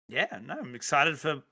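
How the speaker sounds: ordinary talk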